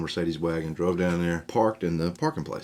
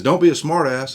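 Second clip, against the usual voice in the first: much higher, much louder yell; angry shout; 9 semitones higher, 11 dB louder than usual. Retold or imitated. Service man yelling at the teen, furious shout. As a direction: blow up at a mouthy kid.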